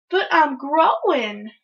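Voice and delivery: slow, high, loud protest; indignant kid arguing back; whining protest. A narrator's reading. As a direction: stretch it out in a child's whine. Child protests mother's scolding.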